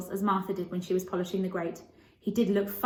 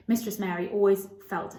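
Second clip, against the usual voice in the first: ordinary delivery, nothing marked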